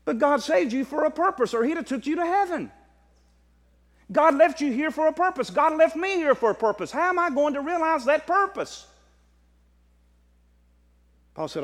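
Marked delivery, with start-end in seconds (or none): 0.1-8.9 high, urgent preacher's appeal; impassioned preaching. Real, not acted. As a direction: press the challenge home in a raised voice. Preaches with raised voice.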